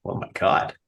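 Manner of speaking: breathy stunned "oh my God"; mock gasp. Mock reaction to genre mashup.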